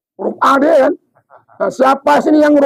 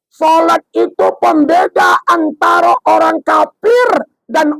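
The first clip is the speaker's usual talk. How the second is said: preacher scolding; 3 semitones higher than usual. Acted. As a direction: shout the warning at the stubborn one. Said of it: "Kata ustadznya".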